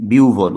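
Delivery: বিহ্বল said as biu-vol; two-part biu-vol; correct pronunciation. Shown as a demonstration. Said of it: "সঠিক উচ্চারণ করার চেষ্টা করব".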